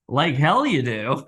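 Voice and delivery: slow, loud, scornful retort; labouring woman snapping back in mock scorn; mock indignant patient. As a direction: throw it back at the doctor. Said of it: "She's gonna be like".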